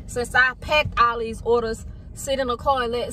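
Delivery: ordinary delivery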